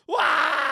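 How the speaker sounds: very long high "Wah!" scream; sustained full-volume wail; game scream. Pretend. Player screaming to survive the round, wailed scream. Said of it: "screaming to survive".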